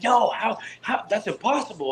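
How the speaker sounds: high, incredulous "no, how"; mock disbelief. Telling his American friends their border trip is impossible, high and disbelieving. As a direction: act shocked it could be so.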